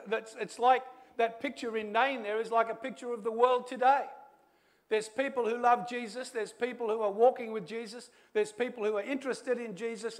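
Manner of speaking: high, loud pulpit delivery; impassioned preaching. Real, not acted. Preacher urging the church to see God moving, impassioned preaching. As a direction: preach it out urgently at full force.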